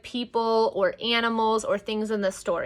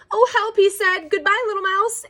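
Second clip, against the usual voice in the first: high, loud yelp of alarm; mock panic; 10 semitones higher, 5 dB louder than usual. Fox fleeing the Gruffalo, high squealing mock panic. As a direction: squeal a hurried farewell.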